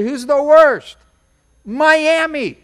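ordinary talk

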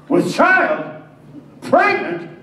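loud, high shocked outcry; stunned disbelief. Acted. Joseph hearing Mary is pregnant, shocked outcry. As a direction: blurt it out, thunderstruck.

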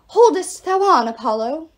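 loud, high archaic proclamation; talking arrow. A narrator's reading. Arrow urging wounded Apollo on, loud grand exhortation. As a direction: exhort like a grand old-world herald.

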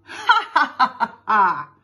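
loud ha-ha, starting high and falling; mock laughter. Kids laugh at pink wafer joke, loud jeering.

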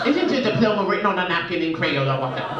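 wife's British put-down. Retold or imitated. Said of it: "that's how she wins the argument".

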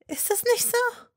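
very high, pointed question; probing. A narrator's reading. Hermine probing Malfoy, very high. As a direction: needle him with it. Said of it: "hakte sie nach".

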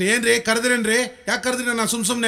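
high, rapid wifely retort; indignant wife. Acted. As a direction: snap back at your husband for calling you. Wife turns it round, snaps back.

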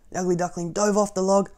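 usual speaking voice

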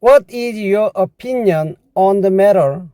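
very slow, every word spelled out; teacher's slow demo read; slow English model sentence. Shown as a demonstration. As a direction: say it slowly for learners to copy. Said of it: "영어로 하면요".

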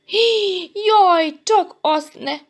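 high, loud alarmed cry; panicky, high-pitched plea; frightened. A narrator's reading. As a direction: gasp and wail in sudden fright. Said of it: "ijedt meg Janka".

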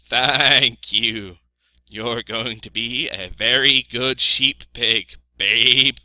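loud, drawn-out bleating praise; thankful sheep. A narrator's reading. Voices the sheep.

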